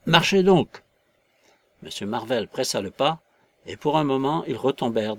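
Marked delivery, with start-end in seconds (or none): 0.1-0.8 loud, high impatient order; sharp command. A narrator's reading. Invisible man hurrying Marvel along, sharp command. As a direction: cut him off with an order.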